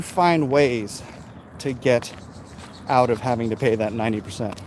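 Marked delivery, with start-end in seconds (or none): none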